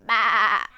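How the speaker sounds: loud wavering baa; sheep's bleat. A narrator's reading. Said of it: "Sheep said".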